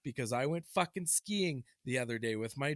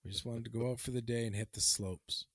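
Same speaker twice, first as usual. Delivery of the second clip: low, soft, deliberate storytelling; story narration; 8 semitones lower, 7 dB quieter than usual. Acted. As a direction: tell it hushed, as a solemn tale. Hushed mock-solemn story narration.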